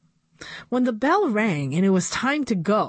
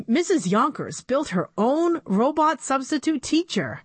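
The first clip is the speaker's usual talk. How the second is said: ordinary delivery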